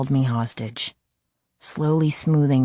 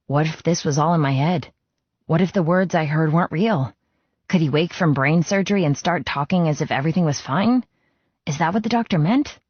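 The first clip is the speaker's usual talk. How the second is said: ordinary delivery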